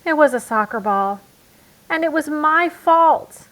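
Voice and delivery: high, loud cub shout, the last words slowed; owning up at the top of his voice. Acted. Brother confessing his fault to Mama, shouting. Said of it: "shouted Brother".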